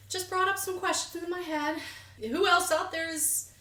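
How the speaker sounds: lightly put-on British reality-show accent; Love Island accent. Podcast host drifting into a Love Island accent. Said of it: "You and that accent".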